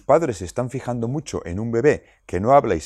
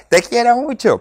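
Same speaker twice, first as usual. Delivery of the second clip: much higher, louder yell of love; shouted affection; 7 dB louder than usual. Retold or imitated. Jealous toddler yelling love into the sleeping baby's ear. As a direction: bellow it right into the baby's ear. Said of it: "A lo mejor le grita".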